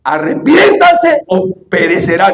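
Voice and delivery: preacher voicing Jesus, shouting the warning; stern warning shout. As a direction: thunder out the warning word by word. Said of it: "le dijo".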